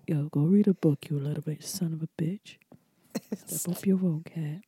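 low, soft, intimate murmur; whispered in the ear. Retold or imitated. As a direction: imitate a lover's hushed pillow talk. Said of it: "He whispered in my ear".

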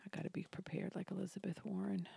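hushed whisper to herself. Real, not acted. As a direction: whisper it under your breath.

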